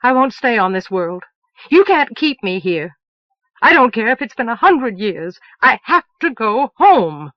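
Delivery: loud and rising defiance; defiant anger. Acted. Moon to those keeping her: loud defiant refusal. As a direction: refuse them with all your force.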